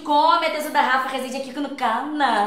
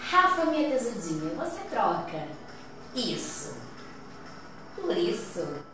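dramatic game host; 6 semitones lower, 9 dB quieter, slower than usual. Acted. Host poses the challenge question.

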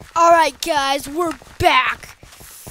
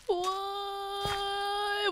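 long, soft, high whine; mock whimper; 5 semitones higher, 14 dB quieter than usual. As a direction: draw it out like a pretend sob. Lost the game.

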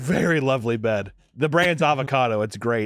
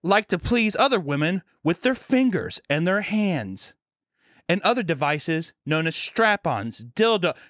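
higher, slow, spelled-out lecture; imitating health teacher; 5 semitones higher than usual. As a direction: enumerate it deliberately in a pitched-up voice. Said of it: "there's a health teacher who was".

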